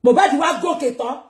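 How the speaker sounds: usual speaking voice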